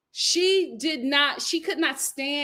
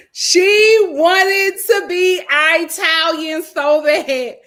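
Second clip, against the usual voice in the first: very slow, loud, stretched words; emphatic teasing; 4 semitones higher, 9 dB louder, much slower than usual. Real, not acted. Host teases a girl's put-on identity, emphatic and drawn out. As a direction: drag out every word in mockery.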